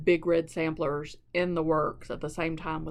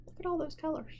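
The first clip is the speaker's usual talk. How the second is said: higher, awed look at the colors; amazed; 10 semitones higher, 4 dB quieter, faster than usual. Real, not acted. Stitcher showing viewers the chart, amazed. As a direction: marvel at how many there are.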